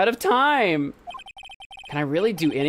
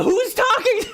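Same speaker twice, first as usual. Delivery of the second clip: shout; 3 dB louder than usual. Real, not acted. Confused by unknown speaker.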